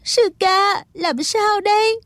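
high-pitched crying plea; high, weepy voice; tearful. Acted. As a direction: ask helplessly through tears. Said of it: "Thích Phương khóc nói".

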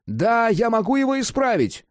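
high, excited shout. A narrator's reading. Cat tells Jack he can fix it, excited. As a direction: pipe up triumphantly at a high pitch.

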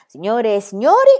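booming ringmaster call to the crowd; circus director announcing. A narrator's reading. Circus director announces to the audience, booming. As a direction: hail the audience like a showman.

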